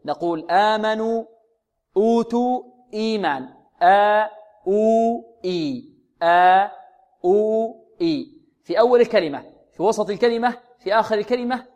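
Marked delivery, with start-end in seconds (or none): 0.5-3.5 آمنوا، أوتوا، إيمان each with a long first vowel; lengthened vowels. Shown as a demonstration. Teacher giving madd badal examples, lengthened vowels. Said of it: "سواء مددته بواو أو بألف أو بياء". 3.8-8.3 آ، أو، إي slowly, each vowel sustained; long held vowels. Shown as a demonstration. Teacher isolating madd badal vowels, long held vowels. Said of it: "بواو أو بألف أو بياء".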